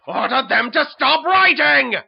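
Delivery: high, very loud enraged order; furious imperious shout; shouted royal command. Acted. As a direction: yell it as a tyrant king losing patience. King orders his minister.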